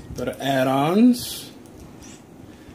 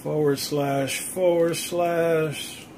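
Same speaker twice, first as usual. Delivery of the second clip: slow dictation; 3 semitones higher, 5 dB quieter, much slower than usual. Real, not acted. Dictating a web address.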